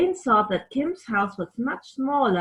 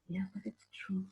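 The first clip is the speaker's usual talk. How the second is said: whisper; 13 dB quieter, faster than usual. Acted.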